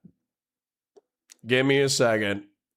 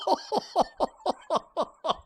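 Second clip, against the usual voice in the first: high, softer wheezing laugh; hysterical laughter; 5 dB quieter than usual. Real, not acted. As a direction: laugh yourself out of breath. Cracks up at the reveal.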